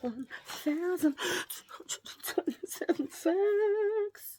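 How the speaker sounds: slow, high sing-song announcer. Pretend. Vlogger announcing her fact segment in a high showman voice. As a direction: boom out the segment title like a showman. Catchphrase repeated.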